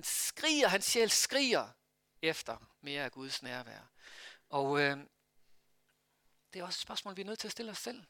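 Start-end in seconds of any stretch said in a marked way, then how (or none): none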